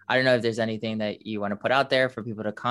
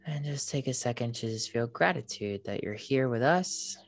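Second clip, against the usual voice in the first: airy breathy mystical healer; 6 dB quieter than usual. Acted. Leads a meditation.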